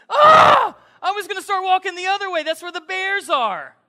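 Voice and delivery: loud, high burst of relief; relieved exclamation. Retold or imitated. Pastor reliving spotting a headlamp in the woods, loud excited relief. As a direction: blurt out your rescue, elated.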